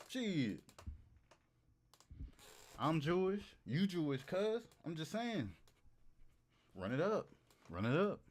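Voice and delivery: ordinary talk, nothing marked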